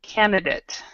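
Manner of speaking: Candidate said as KAN-duh-dayt, the middle vowel weak; teacher's model pronunciation. Shown as a demonstration. Teacher models the word Candidate for students, KAN-duh-dayt. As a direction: Candidate with a light t and a short middle syllable. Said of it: "hear how I'm saying this word".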